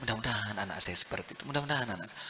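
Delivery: hushed, low, quickened plea, lightly imitating a weeping father; retold tearful prayer. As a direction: pray it softly, twice over, with understated tears. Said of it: "dia lihat sambil nangis".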